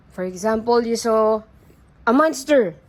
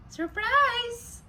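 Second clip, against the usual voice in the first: high, drawn-out surprised cry; acted surprise; 6 semitones higher, 5 dB quieter than usual. Shown as a demonstration. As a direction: model surprise for a child. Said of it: "you look surprised".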